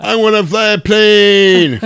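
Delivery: high, loud, slow laughing whine; mock him like a spoiled kid. Teller mocking Maverick as a whiny child, loud slow whine.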